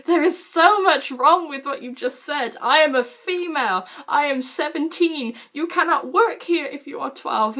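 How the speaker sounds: high-pitched, exasperated comeback; indignant retort. Retold or imitated. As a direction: retell it as an emphatic, indignant correction. Indignant retort to being called twelve.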